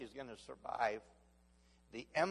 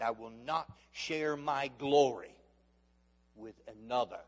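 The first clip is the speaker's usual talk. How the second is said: slow, louder voice of Jesus; slow solemn declaration; 5 dB louder than usual. Acted. Preacher voicing Jesus to the congregation, slow and solemn. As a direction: declare it with gravity.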